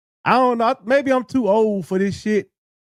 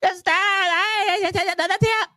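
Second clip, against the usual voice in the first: high, slow, shouted parody of the tip line; mock catchphrase; 10 semitones higher, much slower than usual. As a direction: stretch it out and yell it like a mocking hype man. Mocking the video's catchphrase.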